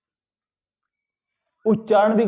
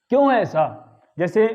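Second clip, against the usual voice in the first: ordinary talk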